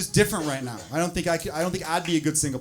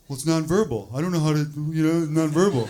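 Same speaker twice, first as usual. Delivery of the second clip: lightly voiced clueless guy, louder; clueless man; 2 semitones lower, 8 dB louder than usual. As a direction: play a hint of a confused dimwit. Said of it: "I know some men here are thinking".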